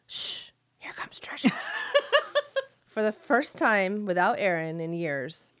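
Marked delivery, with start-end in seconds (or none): 0.1-1.5 hushed, urgent whisper; mother's whisper. Retold or imitated. Mom hushes the family as her daughter arrives, in a whisper. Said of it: "as is her way, she did". 1.5-2.6 breathy giggle under the breath; stifle a giggle; whispered laugh. Real, not acted. Laughing at own story.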